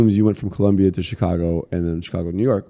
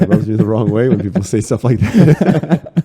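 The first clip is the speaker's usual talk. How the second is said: laughing while talking; 3 semitones higher, 7 dB louder than usual. Real, not acted. Laughing off an age joke.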